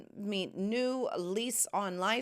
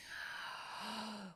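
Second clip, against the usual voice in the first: sharp gasp; 15 dB quieter than usual. Real, not acted. Suddenly remembers trip planning.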